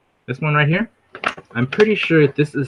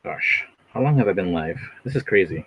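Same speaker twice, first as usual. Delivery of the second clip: ordinary talk, nothing marked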